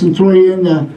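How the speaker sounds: ordinary delivery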